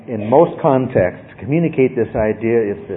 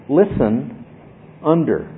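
"Listen" and "under" split by a pause, each word weighted; slow emphasis; slower than usual. Shown as a demonstration. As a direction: let "Listen" hang, then drop onto "under". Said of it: "Think about that".

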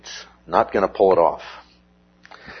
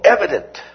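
"Evident" said alone, much higher, falling; emphatic Evident; 8 semitones higher than usual. Shown as a demonstration. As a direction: punch "Evident" up high, then let it drop. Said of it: "It's emphatic".

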